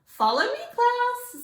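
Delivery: cheerful call. A narrator's reading. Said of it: "said the Frizz".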